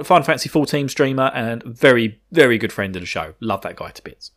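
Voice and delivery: ordinary talk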